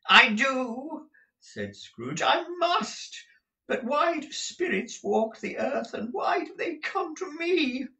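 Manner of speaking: put-on a panicked old man begging for answers; frightened Scrooge. Scrooge answers the ghost in fear.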